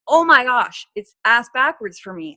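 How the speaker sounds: loud, exasperated realisation; a sudden loud self-aware outburst; loud exclaim. Retold or imitated. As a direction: blurt it out loud at yourself. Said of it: "I'm like".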